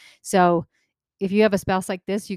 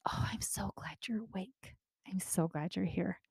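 whispered good morning; 12 dB quieter than usual. Retold or imitated. Parent greets a just-woken child in a whisper. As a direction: breathe it like a child is still waking.

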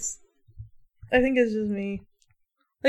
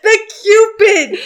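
laughing; 9 semitones higher, 7 dB louder than usual. Real, not acted. Laughing searching for word.

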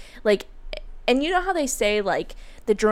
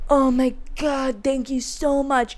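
put-on slow, higher, sloppy drunk thanks; mock-gushing, dragged-out gratitude; drunken gushing; 5 semitones higher than usual. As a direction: imitate a drunk man falling all over someone. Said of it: "falling all over me".

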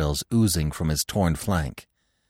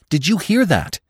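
high, louder urgent question; insistent repeat; 10 semitones higher, 5 dB louder than usual. A narrator's reading. Bambi repeating his warning to Gobo, more insistent. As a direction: press the question with alarm. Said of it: "Bambi repeated".

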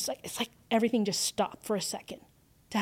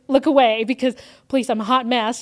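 louder, urgent begging to be left alone; retold plea; 2 semitones higher, 9 dB louder than usual. Hot mess begging a staring stranger to look away, loudly pleading. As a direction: push the words out loudly, half-joking.

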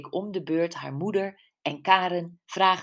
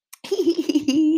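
high, loud giggle; squeaky-high tittering laugh; chuckle; 11 semitones higher, 8 dB louder than usual. Acted. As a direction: giggle out loud at your own joke. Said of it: "grinnikte ze".

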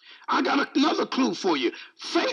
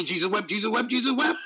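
"Jesus wept" rattled off without a break; rapid repetition. Acted. Prisoner with no Bible reciting to the guards, rapid chant. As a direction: repeat it quickly like a chant. Prisoner repeats his only remembered verse.

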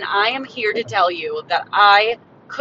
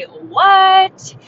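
loud, drawn-out mock amazement; hyped catchphrase; 10 semitones higher, 7 dB louder than usual. Podcaster opening the show to listeners, hyped catchphrase. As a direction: stretch the word out in playful disbelief. Show's exclaimed catchphrase opener.

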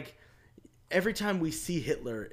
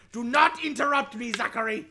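loud, higher mock German scolding; German accent; 6 semitones higher, 6 dB louder than usual. Host scolding his co-host in a mock German accent. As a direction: snap at him in a put-on German accent. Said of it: "the German accent".

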